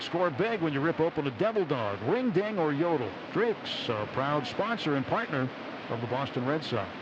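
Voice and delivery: ordinary talk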